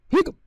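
a short clipped "hic" said as a hiccup; banana's little hiccup; spoken hiccup. Acted. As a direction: pop out a quick hiccup sound. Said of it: "went B one again".

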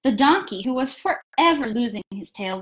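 plain reading voice

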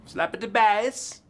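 high, slow put-on Borat voice; Borat accent. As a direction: drawl it out like Borat. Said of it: "That sounded like Borat".

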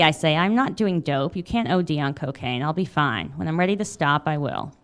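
steady memoir reading voice; reading aloud. A narrator's reading. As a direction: read it like an author at a reading. Memoir read aloud to audience.

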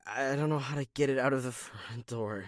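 normal talking voice

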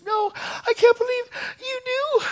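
very high, slow imitated wail; hysterical wail. Sister-in-law learns the speaker knew, hysterical wail. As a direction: wail it out as someone falling apart. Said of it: "She lost it".

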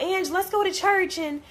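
a churchgoer nagging her friend, mimicked high; imitating churchy Queen. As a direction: play the devout friend urging church. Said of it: "all about".

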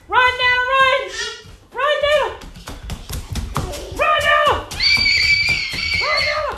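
high excited cheer. Real, not acted. Cheering the baby on to run.

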